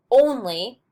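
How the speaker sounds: "only" drawn out, with the weight on ON; first-syllable stress. Shown as a demonstration. Teacher showing a word that breaks the iamb, first-syllable stress. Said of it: "normally takes emphasis in the first syllable".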